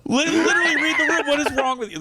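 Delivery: fast, high laughing rebuke; half-laughing exasperated scold. Acted. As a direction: scold them while cracking up. Mock scolding the crude comic.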